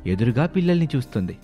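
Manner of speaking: usual speaking voice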